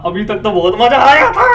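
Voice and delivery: loud, rising into a yell; excited outburst. Real, not acted. Reactor saying it was great fun so far, excited outburst. Same reaction repeated, building to a shout.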